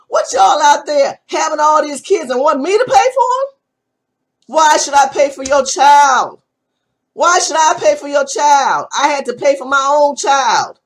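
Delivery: loud indignant demand; furious lecture at viewers; angry shout. Real, not acted. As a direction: hammer the question at them.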